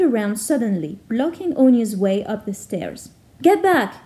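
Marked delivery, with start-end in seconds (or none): 3.4-3.9 sharp loud shout, higher. Acted. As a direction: yell it to stop her. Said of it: "he shouted at her".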